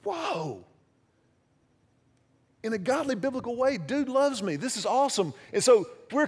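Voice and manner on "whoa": soft, breathless whoa over a gift; taken aback by the gift, softly; awed whoa. Retold or imitated. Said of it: "I was like".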